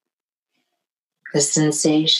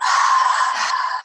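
slow audible breath out through the mouth; long breathy exhale. Shown as a demonstration. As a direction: let a full breath out with a sigh. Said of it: "let all of that out".

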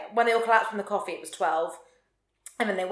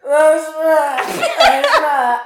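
loud, mocking voice, with a laugh; Toots' line; 5 semitones higher, 9 dB louder, much slower than usual. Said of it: "Toots just goes".

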